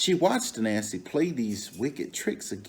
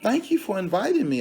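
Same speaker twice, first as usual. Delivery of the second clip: higher, fast and eager; Elephant grateful; 10 semitones higher, 6 dB louder, faster than usual. Acted. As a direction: rush out the thanks, pitched up. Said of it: "said Elephant".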